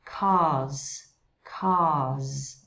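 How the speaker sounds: British "Cars" with the R dropped; British without R. Shown as a demonstration. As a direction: drop the R in "Cars". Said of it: "E o R não se pronuncia".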